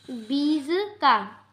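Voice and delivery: ordinary talk, nothing marked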